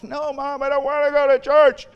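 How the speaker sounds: put-on whiny kid. Kid refusing church.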